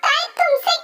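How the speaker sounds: stunned disbelief. Acted. Shock at brother's confession.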